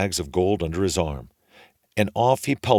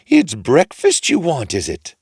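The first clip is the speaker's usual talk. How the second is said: big tall woman; 8 semitones higher, 8 dB louder than usual. A narrator's reading. Tall woman scolding Jack at the door, high and loud. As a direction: scoff down at the boy, shrill-free but loud and high. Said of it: "says the great big tall woman".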